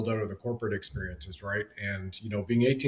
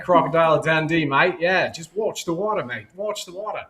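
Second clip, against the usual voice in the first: playful, higher-pitched mock Aussie; Australian accent; 5 semitones higher, 4 dB louder than usual. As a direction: Crocodile Dundee style Australian accent. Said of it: "comes from the guy who did Foster's".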